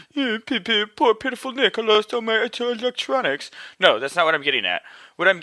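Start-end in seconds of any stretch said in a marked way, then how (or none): 0.1-3.5 high, whimpering poor-me voice; mock self-pity. Host mocking a sob story about lost electronics, mock self-pity. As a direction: put on a pitiful high whine. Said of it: "this isn't a".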